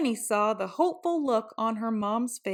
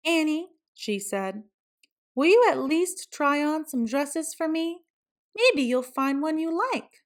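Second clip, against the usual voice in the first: coaxing mom; 6 semitones higher than usual. A narrator's reading. Mom coaxes Annie.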